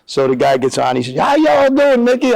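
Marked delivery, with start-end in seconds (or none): 1.2-2.3 high-pitched voiced phone hello; old coach's greeting. Coach greets former player on phone, high-pitched hearty imitation.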